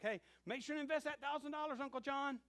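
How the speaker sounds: high, loud call to Uncle John. Retold or imitated. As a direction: shout the instruction across. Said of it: "I'm like".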